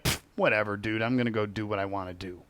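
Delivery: dismissive exploiter. Retold or imitated. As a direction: shrug it off like a lazy rule-breaker. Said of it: "They're like".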